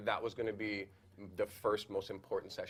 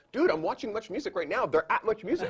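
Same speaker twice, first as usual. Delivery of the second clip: higher and louder, urgent news; excited friend; 10 semitones higher, 7 dB louder than usual. Retold or imitated. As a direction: tell him fast, excited, a laugh at the end. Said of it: "and he's like".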